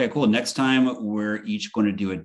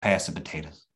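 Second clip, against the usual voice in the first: a mild Southern preachy relative voice; Southern accent. Acted. Uncle lecturing niece at dinner in light Southern accent. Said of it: "my Southern accent's getting more".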